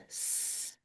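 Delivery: teacher modelling the opening s sound. Shown as a demonstration. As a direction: hiss out "S-" as a long unvoiced s. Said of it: "what does that begin with".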